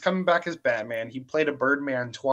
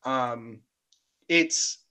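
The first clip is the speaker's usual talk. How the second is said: normal talking voice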